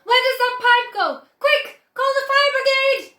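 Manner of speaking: high and loud, in a panic; a mother yelling in panic for the fire brigade; frantic yelling. A narrator's reading. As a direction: shout for help, all alarm. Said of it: "yelled Missus Gloop".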